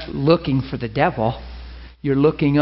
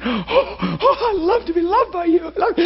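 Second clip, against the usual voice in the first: high, tender mock reply; Jesus answers the ladies, doting; Jesus voice answering; 13 semitones higher than usual. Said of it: "and he's going".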